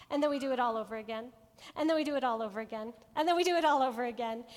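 fast, rising repetition like an endless loop. Shown as a demonstration. Preacher showing the endless cycle, fast and building.

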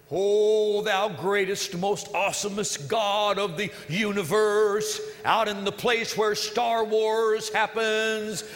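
slow, pompous hammed-up prayer; hammed-up, drawn-out King James prayer; mock formal prayer. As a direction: parody a grand church prayer. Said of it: "We say".

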